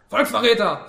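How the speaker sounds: loud, high accusation; angry insult. A narrator's reading. Wounded fascist insults the communist, loud and angry. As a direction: hurl it at your enemy.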